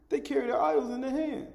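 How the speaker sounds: high-pitched, incredulous aside after a laugh; amused disbelief. Real, not acted. Preacher scoffing at idol carriers, incredulous.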